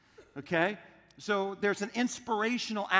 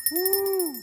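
high, whooping cheer; excited whoop; 12 semitones higher, 3 dB louder than usual. Acted. Pastor cheering like a Salvation Army bell ringer, high whoop.

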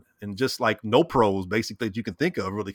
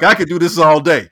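loud, high, amused quoted boast; mock tough-guy boast; 4 semitones higher, 12 dB louder than usual. Host imitates the battered quarterback, loud and amused. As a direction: play the unfazed hero, loud and laughing. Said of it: "He's like".

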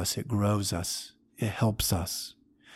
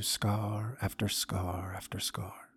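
softer, whispered close to the story; hushed narration; 10 dB quieter, slower than usual. A narrator's reading. Narrator to listeners as the crowd goes silent, whispering.

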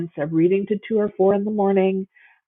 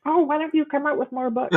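high, pleading fan voice; mock the eager fan begging; whiny reader complaint; 8 semitones higher than usual. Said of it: "the classic reader complaint".

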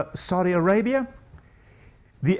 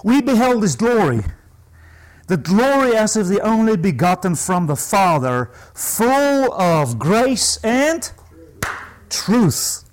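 slow, emphatic proclamation of a Bible verse; scripture reading. Real, not acted. Preacher reads John's verse to congregation, emphatic scripture reading. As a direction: declaim the verse, stretching the last phrase.

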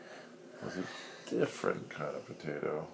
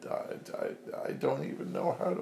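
flustered potato; 6 semitones higher, 4 dB louder, faster than usual. Acted. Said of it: "He's like".

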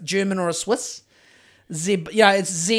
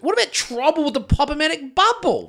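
mock eager pitch; 9 semitones higher than usual. Teasing cohost who hates board games.

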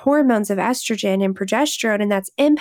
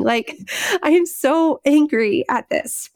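emotional outburst; 5 semitones higher than usual. Real, not acted. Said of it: "I could start crying".

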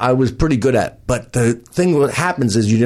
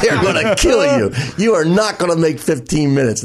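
laughing club owner; 4 semitones higher than usual. Retold or imitated. Club owner laughing as he warns the comic, amused. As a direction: chuckle it out like you can't believe he'll try. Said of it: "the club owner's laughing".

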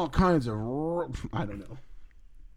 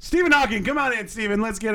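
big talk-show host intro; host announcement; 6 semitones higher, faster than usual. Acted. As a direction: announce a special guest. Introducing a special guest.

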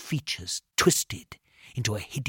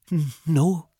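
higher, nervous stammer; halting, frightened no; 5 semitones higher, 4 dB louder than usual. A narrator's reading. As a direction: stumble on the first sound. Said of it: "I stammered".

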